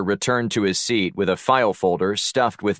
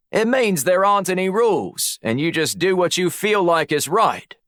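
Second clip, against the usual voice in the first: put-on breezy, higher-pitched Aussie accent; Australian accent; 10 semitones higher than usual. A narrator's reading. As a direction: toss it out like an Australian motto. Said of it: "summoned his best Australian accent".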